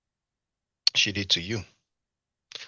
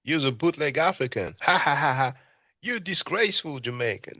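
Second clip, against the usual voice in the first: raised-pitch mock rant, cackling mid-line; imitating the clip's ranter; 6 semitones higher than usual. Host replays the street heckler's jeering taunt for viewers, higher-pitched mock voice. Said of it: "the voice that you hear saying".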